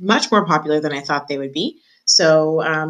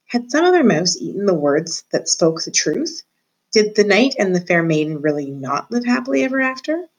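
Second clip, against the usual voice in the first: plain reading voice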